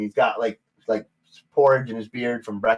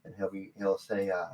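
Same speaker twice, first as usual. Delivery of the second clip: normal talking voice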